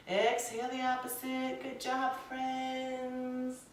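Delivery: slow, soothing instructor cues timed to the breath; guided yoga voice. Acted. Yoga teacher guiding students through poses, soothing. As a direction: talk the class through each breath calmly.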